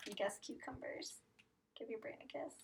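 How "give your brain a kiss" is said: whisper. Acted. Whispered praise to children.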